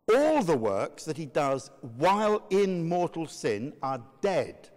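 normal talking voice

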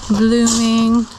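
ordinary talk, nothing marked

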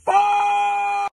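ordinary delivery

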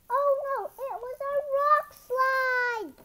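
high, loud and slow, full of alarm; big dramatic oh no; alarmed excitement. A narrator's reading. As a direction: sound the alarm as the rocks fall. Narrator dramatizes rock slide.

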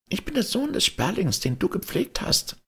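very high, tiny bird voice; little sparrow voice. A narrator's reading. Young sparrow greeting the old man, very high and tiny. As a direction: chirp it like a small polite sparrow.

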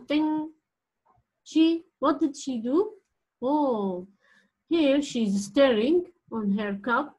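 usual speaking voice

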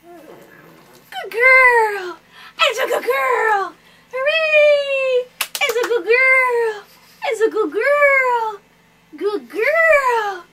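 loud, laughing cheer of praise; excited cheering. Real, not acted. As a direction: celebrate loudly, laughing. Cheering after drops done.

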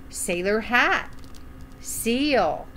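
ordinary delivery

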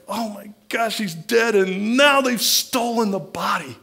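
high, pained cry of loss; grieving woman at the empty tomb, high and anguished; distraught. Acted. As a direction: wail that he's dead and gone, pitched high. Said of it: "She was like".

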